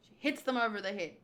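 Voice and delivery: ordinary delivery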